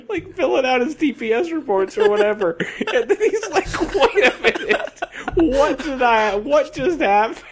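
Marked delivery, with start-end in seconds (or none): 3.9-7.4 high, stretched-out bafflement; mock bewildered boss. Boss interrupted at his paperwork, mock bewilderment. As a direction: play the stunned boss, hammed up.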